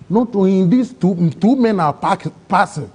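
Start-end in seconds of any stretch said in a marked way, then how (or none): none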